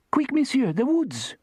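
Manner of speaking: put-on high French-accented woman's voice; Therese's French accent, high and urgent. A narrator's reading. French cook urging P I to hurry, French accent. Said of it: "Therese said".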